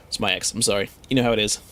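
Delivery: usual speaking voice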